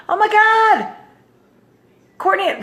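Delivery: high, gushing exclamation; imitated excited squeal. Relative reacting to the trip news, excited.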